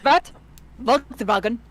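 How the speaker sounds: "Volkswagen" in mock German; mock German accent. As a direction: toss out a German-accented car name.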